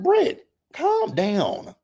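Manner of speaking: very high, slow mock plea; high calm down. Vlogger telling Brit to calm down, high and drawn out. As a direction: stretch it out high and pleading. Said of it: "I was like".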